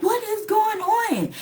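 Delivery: high, exasperated question; exasperated disbelief. Retold or imitated. Finding his friend eating her food: high exasperated disbelief. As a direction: pitch shoots up in annoyed disbelief.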